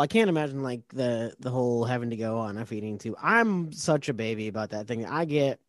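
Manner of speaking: ordinary talk, nothing marked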